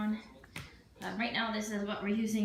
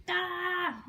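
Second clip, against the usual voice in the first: startled shout then laugh; 8 semitones higher than usual. Real, not acted. Startled by life-size doll.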